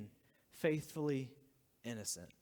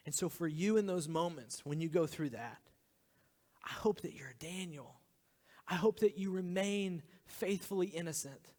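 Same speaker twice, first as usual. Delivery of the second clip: normal talking voice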